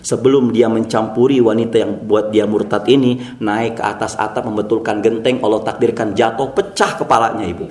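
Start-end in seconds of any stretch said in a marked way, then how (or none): none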